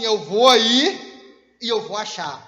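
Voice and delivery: a mother who knows where it is; slow, low mock-mum reply; mother's voice. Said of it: "E a mãe fala assim".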